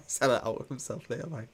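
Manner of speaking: laughing while repeating the words. Real, not acted. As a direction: chuckle through the repeat.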